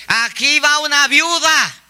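high, drawn-out mock announcement; talking dress announcing. Preacher voices a widow's dress to passers-by, high slow announcing. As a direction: proclaim it slowly and high, like a street crier. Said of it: "el vestido, ¿qué dice?".

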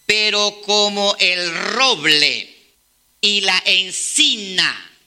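slow, drawn-out solemn reading; solemn scripture reading. Acted. Preacher reading Isaiah to the church, slow and solemn. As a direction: let each word of the verse land slowly.

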